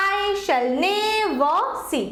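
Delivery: very slow scansion, long "ne" in "never"; stress on NE. Shown as a demonstration. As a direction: stretch "ne" of "never", keep "ver" lighter, then "see". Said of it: "নে বেশি পড়ছে".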